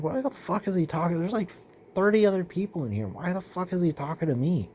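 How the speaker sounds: mock-baffled, pitched well up; bewildered. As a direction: retell your baffled complaint in a high voice. Retells his bewildered reaction to coworker.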